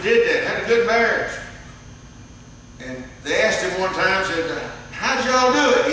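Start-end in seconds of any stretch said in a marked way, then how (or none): 4.9-5.9 eager question. Retold or imitated. Interviewer asks the couple.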